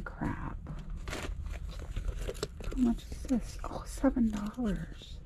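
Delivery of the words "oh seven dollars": normal talking voice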